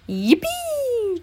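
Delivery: high, drawn-out joyful squeal; cheering. A narrator's reading. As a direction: cheer in a long high whoop. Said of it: "cheered Gopher".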